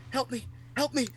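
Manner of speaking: lightly voiced dad, high mock plea; mock plea for help. Dad faking an injury to prank his son, mock plea. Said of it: "he goes".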